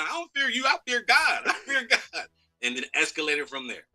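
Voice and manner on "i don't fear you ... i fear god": high, defiant imitation; quoting the famous line. Retelling the famous line to the host, high and defiant. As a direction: repeat the famous line in his raised, cocky voice. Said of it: "The, the famous line".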